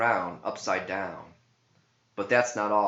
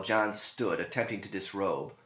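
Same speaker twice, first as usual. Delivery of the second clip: usual speaking voice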